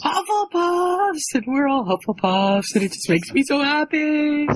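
high-pitched fan delight; happy fan cheer. Real, not acted. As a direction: gush happily about your team. Gleeful fan cheer for Hufflepuffs.

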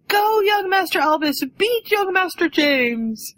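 very high put-on croaky fan screech; Creature screeching. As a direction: cheer from the stands like a screeching servant creature. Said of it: "I can just hear him screeching for him".

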